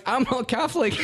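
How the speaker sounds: mock defiant retort. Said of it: "And I was like".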